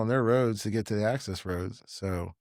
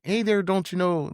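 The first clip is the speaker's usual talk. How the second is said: put-on higher-pitched Fargo-style Midwestern accent; 6 semitones higher than usual. Imitating how Midwesterners talk, Fargo accent. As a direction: imitate an upper-Midwest "don't you know" lilt. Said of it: "they talk like that".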